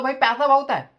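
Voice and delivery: friend bursts out loud and high; mock outburst. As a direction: blurt it out like a stubborn buddy. Friend refuses gym, retold quote.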